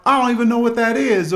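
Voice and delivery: high, fast, loud flustered excuse; husband caught by his wife, stammering a high, fast excuse; stammering liar. Retold or imitated. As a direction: stumble over the lie in a high panic. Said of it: "the multitude of lies to begin".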